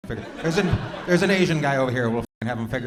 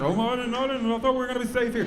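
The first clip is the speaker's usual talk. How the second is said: high, flustered audience voice; hammed-up high pleading, tripping over the words; mock worried protest; 9 semitones higher than usual. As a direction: imitate a nervous guest, pitched high. Mimics worried front-row audience.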